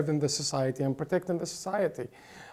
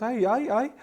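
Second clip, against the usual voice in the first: lightly imitated Australian accent, higher pitched; a higher-pitched hint of Australian twang; mock Sydney accent; 7 semitones higher than usual. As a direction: mimic a Sydney local's accent. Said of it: "Australian Sydney accent".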